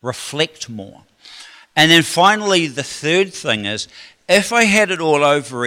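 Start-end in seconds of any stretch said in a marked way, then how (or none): 0.0-1.0 quiet repetition. Shown as a demonstration. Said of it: "repeated key line".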